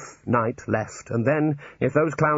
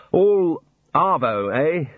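dry mimic of slang; 6 semitones higher, 5 dB louder, much slower than usual. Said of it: "said Rincewind".

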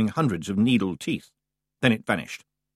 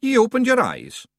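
brisk, higher Scots brogue for the wee man; Scottish Feegle voice; 13 semitones higher, 5 dB louder than usual. A narrator's reading. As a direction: play the cocky little Scot. Rob Anybody voiced in Scots.

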